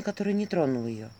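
ordinary delivery

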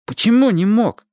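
high, caught-off-guard question; flustered. A narrator's reading. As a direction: ask in confusion. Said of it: "сказал Чик от растерянности".

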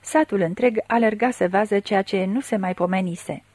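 plain reading voice

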